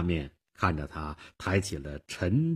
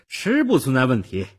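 put-on higher, louder, self-assured girl; confident; 7 semitones higher, 8 dB louder than usual. A narrator's reading. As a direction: state it with confidence. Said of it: "少女很自信地说". (自信地说)

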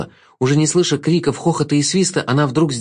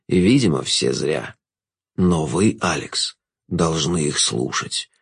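low, measured villain's voice; low, resigned pronouncement; solemn Jester; 8 semitones lower than usual. A narrator's reading. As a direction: state it slowly and low, sinking at phrase ends. Jester states his decision.

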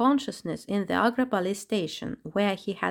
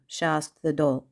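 ordinary delivery, nothing marked

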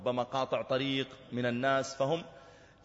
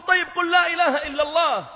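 much higher, louder urgent cry; desperate shout; 11 dB louder than usual. Retold or imitated. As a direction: shout to get through to him. Officer shouting at dying youth. (صيحة يائسة)

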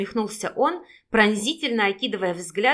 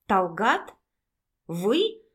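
mild surprise, two short rising questions; surprised; much slower than usual. A narrator's reading. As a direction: ask as if just recognising someone. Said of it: "удивлённо воскликнула Наташа". (с лёгким удивлением)

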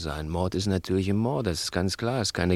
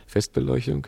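mocking; 7 semitones higher than usual. Mocking the scared partner.

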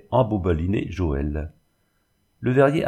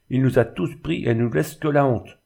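higher-pitched, bitter exclamation; indignant outcry; 5 semitones higher than usual. A narrator's reading. Father shamed by the man who deceived them, indignant outcry. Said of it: "s'écria-t-il".

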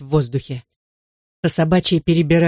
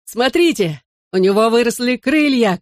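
higher-pitched call of wonder; excited cry; 6 semitones higher than usual. A narrator's reading. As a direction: call friends over to look. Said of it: "крикнул я".

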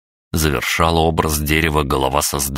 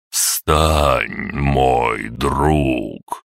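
enveloping slow voice; 2 semitones lower, much slower than usual. Acted. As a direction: wrap the words around him slowly. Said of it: "обволакивающим голосом медленно проговорил".

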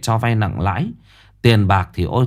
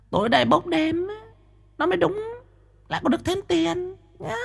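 much higher, softer scheming woman; whispering medium; 11 semitones higher, 6 dB quieter than usual. Acted. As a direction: whisper slyly to your partner. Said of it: "Nó thì thầm".

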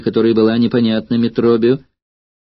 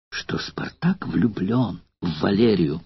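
whisper; 3 semitones lower, 6 dB quieter, slower than usual. Acted. Шёпотом выдаёт секрет.

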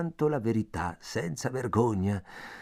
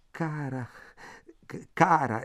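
higher, stammering on the second word; confused exclamation; 4 semitones higher than usual. A narrator's reading. Man halted by her pleading, confused exclamation. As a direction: falter as you call her, at a loss. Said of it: "esclamai confuso".

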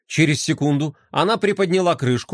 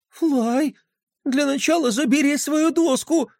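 дрожащий голос; 11 semitones higher than usual. Acted. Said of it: "дрожащим голосом попросила она".

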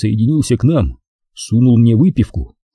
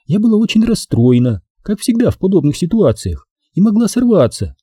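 high-pitched woman excusing herself; Maxine's high, intimate confession; confiding; 9 semitones higher than usual. A narrator's reading. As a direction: explain confidingly in a high female voice. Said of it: "Максин доверительно улыбнулась".

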